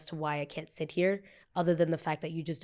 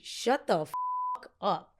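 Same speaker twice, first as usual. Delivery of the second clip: slow dismissive retort; much slower than usual. Retold or imitated. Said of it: "I look at him and I was like".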